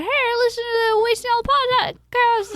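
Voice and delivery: high, loud put-on fan squeal; excited fan. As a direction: gush like a fan rushing up, hammed-up. Imagined fan running up to greet her.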